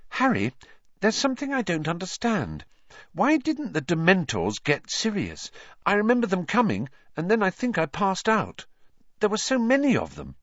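high-pitched character voice; high, troubled questioning; Hermione, earnest and puzzled. Acted. Voices Hermione puzzling over Dementors.

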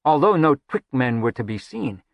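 ordinary delivery, nothing marked

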